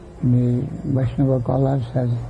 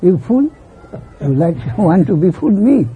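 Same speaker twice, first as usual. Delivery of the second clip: mother scolding; 6 semitones higher, 3 dB louder than usual. Retold or imitated. Mother accuses child.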